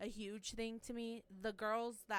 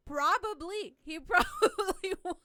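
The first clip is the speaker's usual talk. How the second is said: louder and higher, laughing through it; laughing agreement; 9 semitones higher, 12 dB louder than usual. Retold or imitated. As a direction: giggle out the reply. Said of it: "And I'm like".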